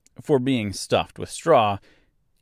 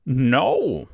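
scarecrow voice; 6 semitones higher, 6 dB louder than usual. A narrator's reading. Scarecrow answers.

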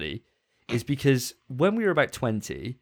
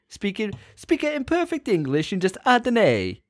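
high-pitched mock Italian with extra "a" sounds; Italian accent; 9 semitones higher than usual. Shown as a demonstration. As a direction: demonstrate a hammed-up Italian accent. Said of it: "for Italian, you just gotta say it".

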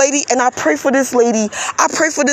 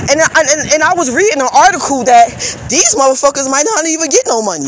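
usual speaking voice

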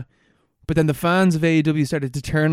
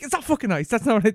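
much higher, frustrated denial; 6 semitones higher than usual. Retold or imitated. Customer rejecting the bartender's guess, high and frustrated. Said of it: "I was like".

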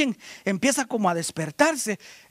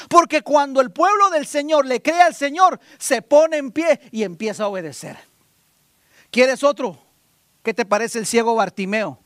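usual speaking voice

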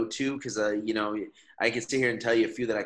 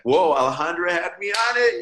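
much higher and louder, wowed; amazed boast; 10 semitones higher, 9 dB louder than usual. Pretend. Guest imagining bragging about the famous podcast, excited. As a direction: gush like a fan bragging. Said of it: "and then be like".

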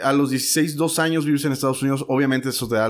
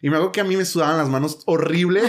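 ordinary talk

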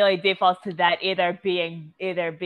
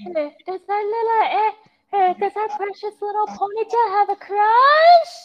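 much higher, louder doting voice; high teasing parent; 7 dB louder than usual. Acted. Parent teasing child in story.

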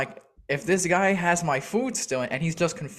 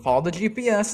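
higher-pitched, quicker exasperated repeat; 6 semitones higher than usual. Retold or imitated. Repeating directions to drivers who always get lost, exasperated. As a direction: push it out high, out of patience.

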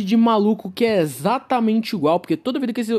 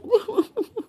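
snooty laugh; 14 semitones higher than usual. Retold or imitated. Mocking rich man's laugh.

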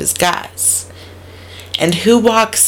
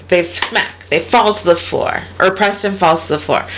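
usual speaking voice

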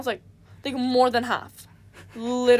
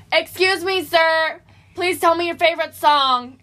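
higher and louder, mock polite; really loudly; 5 semitones higher, 6 dB louder, slower than usual. Asking a guy in class his favorite song: loud mock-polite call. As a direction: call it out loud across the room. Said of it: "And, like, really loudly".

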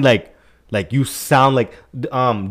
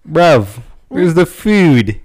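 louder, slow London slang voice; London accent; 5 semitones higher, 8 dB louder, much slower than usual. Shown as a demonstration. Friend showing the only British line he knows, slow London accent. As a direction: lean into a London roadman accent. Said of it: "You wanna hear mine?".

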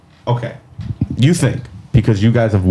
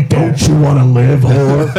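laughing taunt; 2 semitones higher, 3 dB louder than usual. Retold or imitated. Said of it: "Like that".

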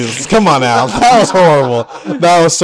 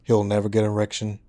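grave doctor voice; 6 semitones lower, 10 dB quieter than usual. Acted. Doctor delivers bad news.

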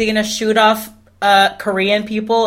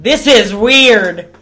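mock exclamation; 2 semitones higher, 6 dB louder, much slower than usual. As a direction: belt it out slowly, in mock alarm. Acts out what she should say.

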